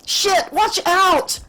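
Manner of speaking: very high, drawn-out cry; scream of warning. A narrator's reading. Captain warning her pilot of an asteroid, high scream. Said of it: "to scream at her pilot".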